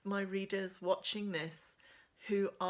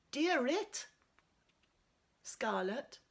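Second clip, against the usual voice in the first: Irish greeting; 7 semitones higher, slower than usual. Acted. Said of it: "chapter eight has got some Irish phrases in it".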